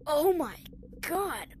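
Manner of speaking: loud high shocked cry. Real, not acted. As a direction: gasp out in shock. Shocked exclamation.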